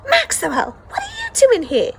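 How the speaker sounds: high, sharp calling out his name; startled call. Acted. Sister catching her brother sneaking out, surprised shout. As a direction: catch him red-handed, loud and high.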